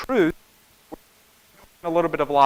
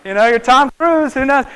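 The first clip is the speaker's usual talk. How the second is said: playful voice; 6 semitones higher, 5 dB louder than usual. Retold or imitated. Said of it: "he's like".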